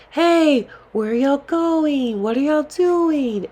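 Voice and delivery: high, drawn-out catcalling yell; imitated yelling. Men harassing women walking back to their resort, mock yelling. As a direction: call out after someone, stretching the words high. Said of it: "the men began to yell at us".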